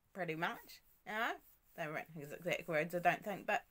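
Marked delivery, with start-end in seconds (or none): none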